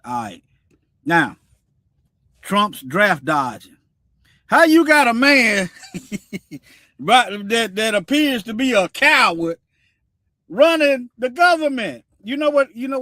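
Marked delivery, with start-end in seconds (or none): 4.5-7.0 loud, higher outburst breaking into laughter; incredulous laughing. Real, not acted. Host scoffing at a draft dodger commanding the military, incredulous laughing. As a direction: ask it loudly, cracking up at the end.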